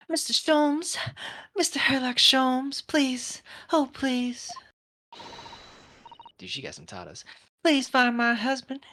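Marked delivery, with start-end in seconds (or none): 0.1-4.5 very high, pleading seductress voice; hammed-up Southern belle damsel. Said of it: "give her a little seductra". 7.6-8.8 high, pleading Southern belle; hammed-up Southern belle damsel. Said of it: "she's becoming a Southern belle too".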